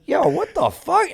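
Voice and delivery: very high, shocked cry; startled yelp. Retold or imitated. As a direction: yelp in sudden panic. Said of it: "I just remember going".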